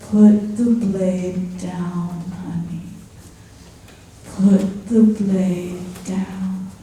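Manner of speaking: slow, soft and soothing; calm mother voice. Retold or imitated. Angel calmly telling her to drop the blade. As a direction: gently talk her out of it. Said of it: "a voice of a very calm mother".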